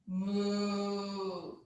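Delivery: long, low cow moo. Shown as a demonstration. Yoga teacher looking up in cow pose, low mooing. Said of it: "give it a little moo".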